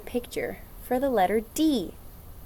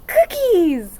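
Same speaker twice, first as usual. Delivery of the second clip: excited; 9 semitones higher, 8 dB louder than usual. Acted. Excited word reveal to kids.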